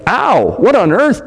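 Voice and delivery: a high, startled yelp; jolted by a sudden sting; boy suddenly stung, cries out in surprise; startled cry. A narrator's reading. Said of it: "made a noise like".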